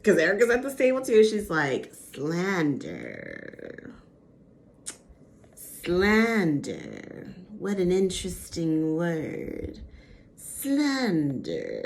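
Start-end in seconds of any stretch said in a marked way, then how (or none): 2.1-3.9 low, soft villainess savoring slander; Disney villain voice. Retold or imitated. Erica relishing the word at Sutton, low villain purr. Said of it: "full Disney villain voice on that woman". 5.5-11.8 slow, relishing villainess repeating slander; a hint of a cartoon villainess lingering on slander; Disney villain voice. Erica mocking Sutton's word at the table, lightly voiced villainess. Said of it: "full Disney villain voice on that woman".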